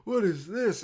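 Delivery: imitating a kid disgusted by wet clothes; mock yuck, what is this; disgusted toddler. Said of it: "looked down at your wet clothes like".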